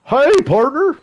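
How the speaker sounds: high, loud put-on cowboy greeting; hammed-up, squeaky-high howdy; mock partner greeting. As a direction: call it out in an exaggerated high pitch. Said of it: "Why they gotta say it like that?".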